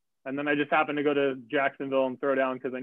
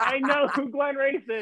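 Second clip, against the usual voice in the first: high, drawn-out delighted insistence; excited recognition; 9 semitones higher, slower than usual. Retold or imitated. Runner realising he knows the man ahead, excited. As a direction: stretch it out slowly, pitched way up.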